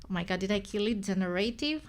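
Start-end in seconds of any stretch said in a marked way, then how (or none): none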